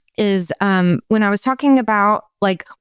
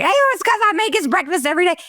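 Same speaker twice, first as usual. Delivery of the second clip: quick, high Long Island wife voice with a New York twang; Long Island accent; 10 semitones higher, faster than usual. Retold or imitated. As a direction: rattle it off fast in a thick Long Island accent. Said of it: "like, Long Island accent".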